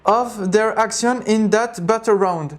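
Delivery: slow, stumbling English in a French accent; thick French accent. Real, not acted. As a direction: sound out hard English words with a French accent. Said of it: "j'ai vraiment un accent de merde".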